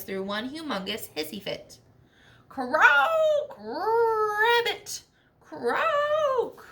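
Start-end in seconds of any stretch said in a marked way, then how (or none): 2.5-6.7 high, loud wailing croaks from a furious toad. Acted. Toad throws a hissy fit at Armadillo's news, wailing high croaks. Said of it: "she wailed and she railed".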